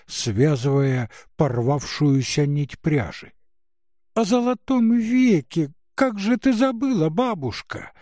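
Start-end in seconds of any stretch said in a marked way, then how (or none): 4.1-7.9 высокий громкий детский голос; звонко и настойчиво, по-детски; голос девочки. Acted. Девочка напоминает бабушке. (девочка с упрёком)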